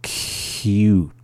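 "Cute" with a long hissy sound after the C; slow, held onset. Shown as a demonstration. Teacher showing learners the sound, "Cute" slowly with its first sound held. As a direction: stretch the start of "Cute" very slowly. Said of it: "saying it very slowly".

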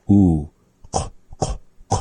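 oo then whispered k, k, k; rounded k demo. Shown as a demonstration. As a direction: round the lips on oo, then click out a voiceless k. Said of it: "taking that oo vowel and having the mouth positioned".

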